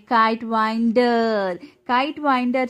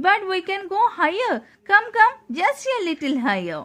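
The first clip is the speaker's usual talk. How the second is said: high, fast coaxing eagle voice; playful teasing eagle, a bit higher and quicker; 8 semitones higher, faster than usual. Acted. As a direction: coax him higher, lightly voiced. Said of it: "King Eagle said softly".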